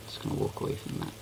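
whispered aside. Shown as a demonstration. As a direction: breathe it out under your breath. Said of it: "repeats it aloud".

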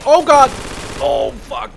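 sudden high, loud panicked yelp; real jump-scare shout mid-game; startled yell. Real, not acted. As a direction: caught off guard, blurt it out in alarm. Suddenly attacked mid-sentence.

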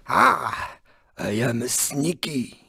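low, soft scheming murmur; hushed villainous self-praise; sly gloating. Acted. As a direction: confide it quietly, pleased with the trick.

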